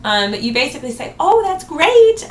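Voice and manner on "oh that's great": much higher, louder and slow "Oh, that's great"; drawn-out, gushing approval; confident delight. Shown as a demonstration. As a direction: greet the objection like wonderful news. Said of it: "then confidently overcome it".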